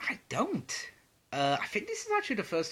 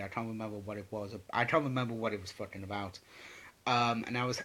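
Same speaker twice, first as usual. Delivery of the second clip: usual speaking voice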